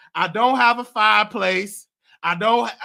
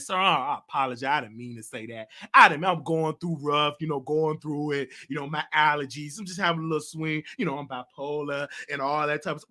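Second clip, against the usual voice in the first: much lower and softer, humble apology; meek apology; 8 semitones lower, 3 dB quieter than usual. Retold or imitated. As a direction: sheepishly say sorry, voice dropped low. Apologizing on the phone.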